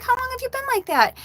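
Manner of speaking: very high-pitched questioning; squeaky-high retold disbelief; retold alarm. Owner to her stuck cat, high alarmed questioning.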